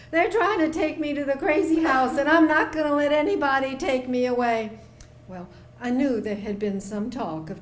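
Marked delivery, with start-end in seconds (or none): 0.1-4.8 whiny voice. Retold or imitated. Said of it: "he had this whiny little voice".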